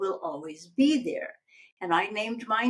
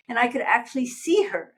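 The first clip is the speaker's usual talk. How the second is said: usual speaking voice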